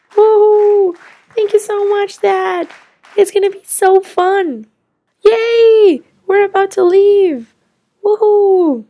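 high, loud, bouncing joy; excited kid cheer. Acted. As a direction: shriek with delight. Kids celebrate before the flight.

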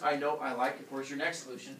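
ordinary delivery

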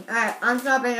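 usual speaking voice

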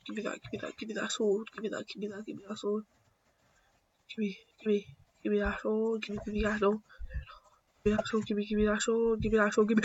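hushed, repetitive chant; muttered begging. Real, not acted. Gamer wanting that sword, muttering very softly on repeat.

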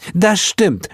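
high-pitched, emphatic agreement; high character voice, insistent; Heel chiming in. A narrator's reading. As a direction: jump in to back him up. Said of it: "sprang Heel ihm bei".

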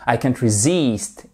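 resist avec -SIST appuyé; accent sur la seconde syllabe. Shown as a demonstration. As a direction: fais ressortir la fin de resist. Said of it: "votre accentuation sur la seconde syllabe". (resist, seconde syllabe accentuée puis descendante)

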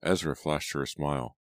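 ordinary delivery, nothing marked

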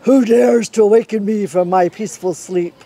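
mildly voiced angry bear; woken angry bear. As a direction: demand it like a grumpy giant.

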